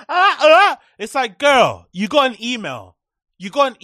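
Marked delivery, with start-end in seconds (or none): 0.1-0.8 high, loud mock gasp of shock; mocking her fake shock. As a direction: ham up a startled gasp. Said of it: "overreactions covering the mouth".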